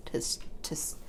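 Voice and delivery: soft unvoiced false start; whispered stumble. Real, not acted. Crafter trailing off mid-sentence while tearing fabric, whispered. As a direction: stumble over the word under the breath. Quiet aside while working.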